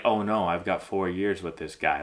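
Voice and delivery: low, soft dread; dismayed player. Acted. Player stuck with a chop-down coach, quietly dismayed. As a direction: let it sink, quietly dismayed.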